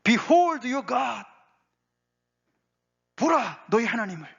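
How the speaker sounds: high, loud herald's proclamation; ringing pulpit cry. Acted. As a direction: call it out to a whole nation. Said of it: "그거를 외치라는 거예요".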